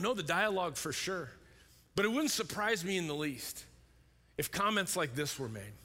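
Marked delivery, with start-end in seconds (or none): none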